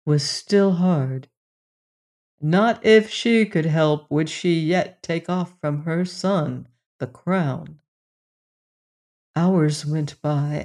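ordinary delivery, nothing marked

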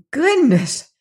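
put-on girl cries out in shock during the earthquake; startled cry. A narrator's reading. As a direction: cry out as the ground cracks open. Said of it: "she cried".

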